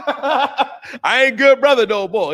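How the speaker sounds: high, slow, laughing it out; amused laughing confession. Real, not acted. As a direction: laugh through the line at his own demotion. Laughs at being called devil.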